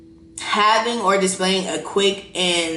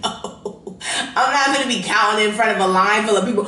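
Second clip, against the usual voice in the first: ordinary talk, nothing marked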